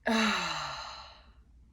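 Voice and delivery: loud voiced exhale, falling away; vibrating sigh. Shown as a demonstration. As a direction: release the tension in a long moaned "ahh". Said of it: "people om, 'cause that vibration does release".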